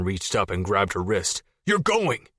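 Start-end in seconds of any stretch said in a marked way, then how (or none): 1.6-2.3 angry. Acted. Jason yelling.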